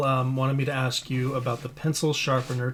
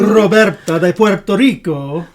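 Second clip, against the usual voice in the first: put-on loud, higher, showy Spanish accent; gringo Spanish accent; 5 semitones higher, 10 dB louder than usual. Thanking donor Roberta in a playful gringo Spanish accent. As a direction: roll out a hammed-up Spanish accent. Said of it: "bad gringo Spanish accent".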